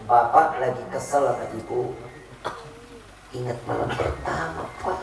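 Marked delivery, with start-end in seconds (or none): 3.3-5.0 whisper. Acted. Intimate reminder to husband.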